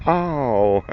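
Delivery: ordinary talk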